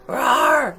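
short, loud playful roar; mock raging roar. Reader showing children how hunger turns you wild, mock roar. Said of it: "like a raging maniac".

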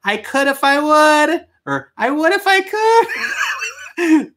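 very high, drawn-out caricature; hammed-up squeal breaking into laughter; mock apologetic manager. Manager telling a customer she can't sell it, hammed-up apology.

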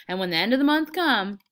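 feed him the line excitedly; lifting, impatient cue; eager prompting. Acted. Lenny eagerly prompts the recited line.

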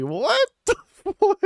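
high-pitched startled "what?"; shocked squeal. Real, not acted. Streamer shocked by a game twist, squealing high. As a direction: squeak out the disbelief, half laughing.

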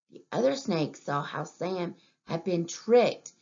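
ordinary delivery, nothing marked

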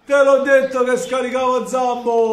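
loud, slow triumphant shout; excited yell. Real, not acted. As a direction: yell it out after scoring. Celebrating goal, yelling.

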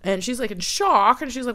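ordinary delivery, nothing marked

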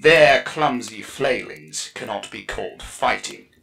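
scornful, forceful Exarch voice; sneering Drytha. A narrator's reading. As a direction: dismiss them with aggressive contempt. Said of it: "Sariel could picture her sneer".

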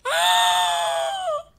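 high loud scream of fright; terrified scream. Real, not acted. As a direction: scream at a sudden jump scare. Sudden scare.

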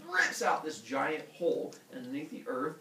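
plain reading voice

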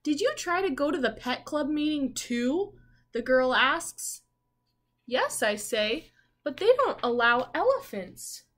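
storybook voice for little listeners; story reading. Acted. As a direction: read the girl's line and the boy's answer to the class. Reads the picture book aloud.

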